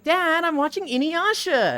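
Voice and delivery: high-pitched mock whine at dad; protesting daughter. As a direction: imitate a girl annoyed at her father.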